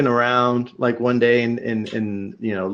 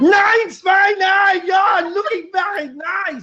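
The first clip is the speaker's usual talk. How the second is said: mock sexy German; slower than usual. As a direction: bark fake German like a cartoon seductive German. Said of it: "really sexy German talk".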